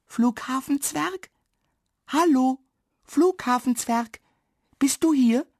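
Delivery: high singsong calling, with gaps to listen; searching call. A narrator's reading. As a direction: call out for someone hiding. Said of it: "eine Stimme leise rufen".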